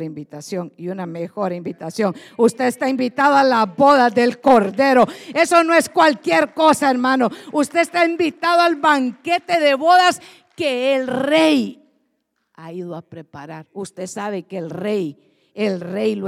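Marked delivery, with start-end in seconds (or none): 2.4-13.7 loud, ringing preacher's proclamation; fiery sermon climax; full preaching. Acted. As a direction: proclaim the invitation to the whole church.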